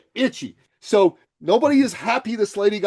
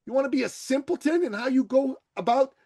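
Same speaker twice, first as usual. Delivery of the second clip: ordinary talk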